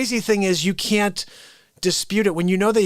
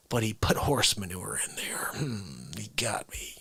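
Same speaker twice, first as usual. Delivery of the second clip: whisper; 9 semitones lower, 10 dB quieter than usual. Pretend. Whispered aside about the manure.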